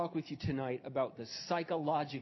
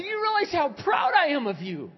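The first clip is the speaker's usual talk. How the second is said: louder, higher, fervent insistence; insistent repetition; 9 dB louder than usual. Retold or imitated. Pastor repeating his pride to a disbelieving woman, insistent. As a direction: drive it home at full voice. Repeats it after she says What.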